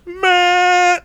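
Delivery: high drawn-out groan of man; exasperated man. Retold or imitated. Stuck behind Karen in drive-through, high exasperated groan. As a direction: whine it like a horn blast. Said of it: "put my hand on my horn".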